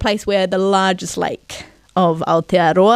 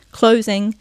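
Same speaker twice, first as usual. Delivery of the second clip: ordinary delivery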